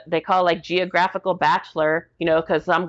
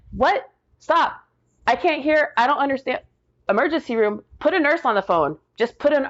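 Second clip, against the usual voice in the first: higher, urgent, bewildered reply; acted-out baffled urgency; retold alarmed confusion; 8 semitones higher than usual. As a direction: cut in on a frantic caller, not understanding. Retold confused reply to hysterical caller.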